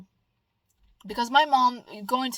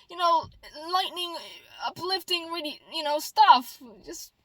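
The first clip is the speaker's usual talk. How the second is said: overwhelmed pleading; 8 semitones higher, 5 dB louder than usual. Retold or imitated. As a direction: beg for time like a wobbly baby deer. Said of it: "and I'm like".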